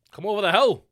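ordinary talk, nothing marked

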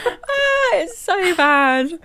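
high wheezy laugh into words; laughing so hard it squeaks, slow; crying with laughter. Real, not acted. Laughing at cringe scene.

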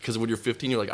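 normal talking voice

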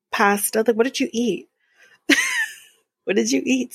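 ordinary talk, nothing marked

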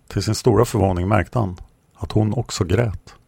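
ordinary delivery

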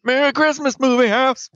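high strained shouting comic voice; Bobcat Goldthwait impression. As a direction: yelp it high and raspy. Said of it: "I'm saying it like Bobcat Goldthwait".

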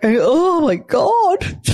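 high, slow, swooning mock despair; drawn-out, high-pitched exasperated gush; gushing oh my god. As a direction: melt over the crying babies. Mum melting over crying babies.

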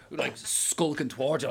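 ordinary talk

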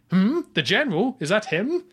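high, hammed-up mock surprise; badly acted surprise. Imitating a poorly acted actress asking her lover about the general. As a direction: overplay a fake double take. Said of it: "she's quite poorly acted".

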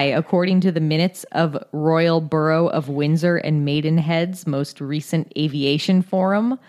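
ordinary talk, nothing marked

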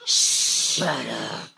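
low growling Queens librarian; tiger librarian from Queens. Acted. Librarian shushing a patron, low growling Queens voice. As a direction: snarl it like a big-cat librarian from Queens. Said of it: "Tiger librarian from Queens".

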